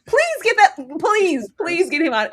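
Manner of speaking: high, loud revolted pleading; re-enacted outburst, easing off at the end; exasperated disgust. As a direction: beg loudly to be rid of someone. Re-enacts revolted reaction to him.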